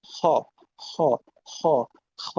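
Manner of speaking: হ and খ as loose scraped sounds from the back; back-of-tongue kh. Shown as a demonstration. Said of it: "হ হচ্ছে পিছনের দিকে জিভ লাগছে". (জিভের পেছন থেকে ঘষা হ)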